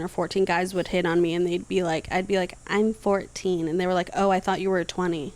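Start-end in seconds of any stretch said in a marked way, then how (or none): none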